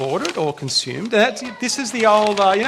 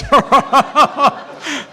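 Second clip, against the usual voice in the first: a burst of laughter at the joke; real laugh; 7 semitones higher, 4 dB louder than usual. Real, not acted. Preacher laughing at the congregation's joke, a real laugh. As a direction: laugh out loud, a little higher.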